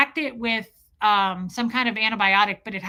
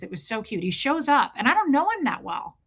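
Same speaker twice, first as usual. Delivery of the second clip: plain reading voice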